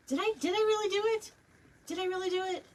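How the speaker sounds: high, fast disbelief; can't believe it worked; incredulous. Real, not acted. Amazed at own bow.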